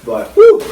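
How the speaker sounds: high loud whew from the burn; loud heat whew. Real, not acted. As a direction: blow out the heat. Reacting to spicy heat.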